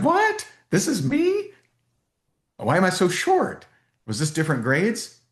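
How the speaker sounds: very high, shocked squeal; mock disbelief. Welch seeing his childhood photo, high mock shock. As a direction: play him stunned by the photo. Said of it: "He was like".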